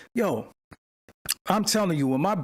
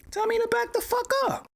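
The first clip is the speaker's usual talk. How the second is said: much higher, squealing demand; high-pitched outburst. Real, not acted. Streamer yells at his chat in a high squeal. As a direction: shoot the voice way up in mock outrage. Yelling at chat to warn him.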